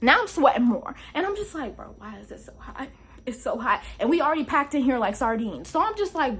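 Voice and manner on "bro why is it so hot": much softer, almost under the breath; soft complaint about the heat; mutter. Retold or imitated. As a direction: complain to yourself softly.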